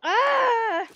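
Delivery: pained yell. Real, not acted. Pain from hot sauce.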